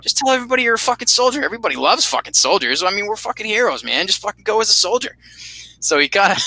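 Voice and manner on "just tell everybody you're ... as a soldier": fast, high, pushy hype-up; egging on excitedly. Retold or imitated. To his buddy Steve, insisting he go as a soldier, fast and high. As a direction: talk him into it, rapid and pitched up.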